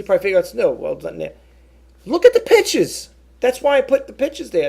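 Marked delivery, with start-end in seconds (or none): 2.1-4.7 sudden higher, louder outburst at careless buyers; fed up, throw it at them; exasperated outburst. Real, not acted. Seller venting at buyers who skip photos, exasperated and loud.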